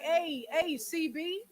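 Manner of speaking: normal talking voice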